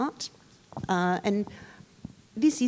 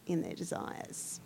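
soft secretive whisper; 3 semitones lower, 10 dB quieter than usual. Real, not acted. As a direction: drop to a whisper. Teasing about secret desires.

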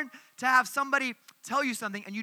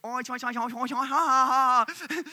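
teasing nonsense syllables; made-up gibberish; 3 semitones higher, 4 dB louder than usual. Retold or imitated. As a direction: fake a language with nonsense sounds. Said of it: "we would just make up sounds".

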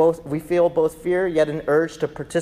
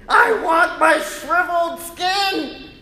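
high, loud, slow pleading snake; whiny snake voice; 14 semitones higher, 6 dB louder, slower than usual. Pretend. Preacher voicing a snake clinging to old skin, high pleading whine. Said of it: "like a snake during molting season".

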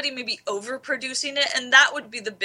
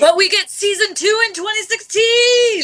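much higher, louder excited shout; 11 semitones higher, 9 dB louder than usual. Real, not acted. Co-host cheers over the host, shouting with excitement. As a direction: shout the good news out.

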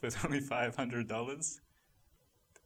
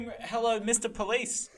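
ordinary talk

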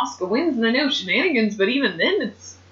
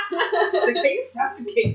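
laughing repeat; 8 semitones higher, much slower than usual. Real, not acted.

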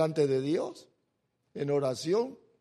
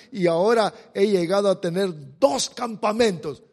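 preaching at full force; 7 dB louder than usual. Acted. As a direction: proclaim the verse to the congregation. Reading the verse with force.

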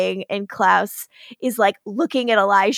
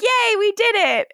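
cheer; 9 semitones higher than usual. Retold or imitated. Cheering characters.

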